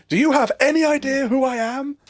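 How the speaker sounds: high, loud self-important demand; indignant passenger. Retold or imitated. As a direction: play the outraged VIP, pitched high and loud. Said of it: "And he goes".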